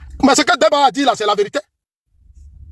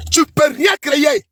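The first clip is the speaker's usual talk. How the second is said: slow shouted repeat; 4 semitones higher, slower than usual. Real, not acted.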